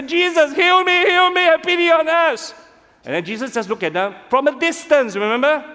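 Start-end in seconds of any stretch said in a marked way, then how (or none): 0.0-2.5 high, loud pleading shout; desperate lepers. Acted. Ten lepers shout to Jesus from a distance, desperate. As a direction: beg to be healed from afar.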